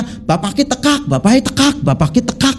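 preacher mimicking Banyumas speech, ngapak accent. Shown as a demonstration. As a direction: chant bapak eh tekak like a Brebes speaker, tekak open and clipped. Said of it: "ngomongnya beda".

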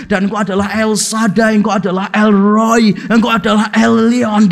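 intoned litany of holy names; long-winded prayer imitation. Minister listing God's names in prayer, imitated intoned litany. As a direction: roll out each divine name with ceremony. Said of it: "doanya kurang lebih begini".

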